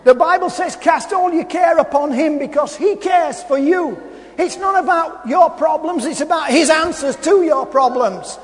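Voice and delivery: loud, high-pitched preacher in full flow; impassioned sermon delivery; fervent preaching. Real, not acted. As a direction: preach it out to the whole room, punching key words. Preaches with rising fervour.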